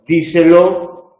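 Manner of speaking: "Díselo" slow and loud, first syllable stressed. Shown as a demonstration. Teacher showing where the accent falls, stress on DI in "Díselo". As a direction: lean on "Dí" and stretch out "Díselo". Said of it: "es esdrújula y lleva acento en di".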